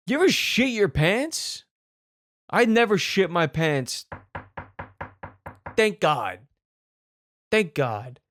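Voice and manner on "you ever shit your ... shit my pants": ordinary talk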